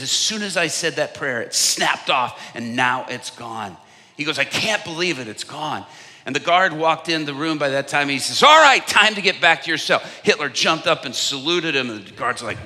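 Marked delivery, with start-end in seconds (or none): 0.0-5.9 louder, excited retelling; excited, raised voice; joyful. Retold or imitated. Voicing Hitler's joyful release. 8.4-10.0 loud, higher guard call; louder, higher call; guard's call. Retold or imitated. Guard calling time.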